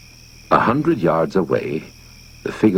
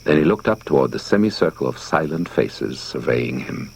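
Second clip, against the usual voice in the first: plain reading voice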